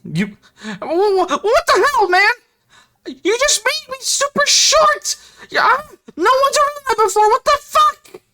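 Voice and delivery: tiny squeaky voice. Acted. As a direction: squeak like something shrunk small. Shrunken creature yells in outrage.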